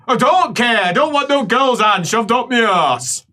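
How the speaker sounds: high, indignant patient voice; acted indignant protest. Patient refusing the girls' help, acted indignant protest. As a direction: refuse the treatment crossly.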